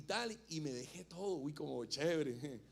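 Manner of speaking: slow, savoring fantasy of easy money; lightly voiced worldly youngster dreaming; mock dreamy youth. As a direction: drawl it out like a daydreaming kid. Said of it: "Esos son los que son más mundanos".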